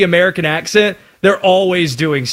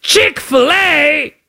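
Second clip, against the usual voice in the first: high, drawn-out mock British cry; bad British accent; 12 semitones higher, much slower than usual. Host voicing a British fan in a bad British accent. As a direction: stretch it out like an excited tourist. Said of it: "My accents are awful".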